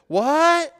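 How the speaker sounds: high, loud, startled "What?" from far off; wife calling back. Retold or imitated. Wife on the pool deck shouting back to husband, puzzled.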